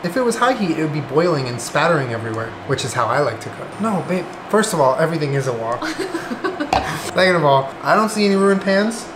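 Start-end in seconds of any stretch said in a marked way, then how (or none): none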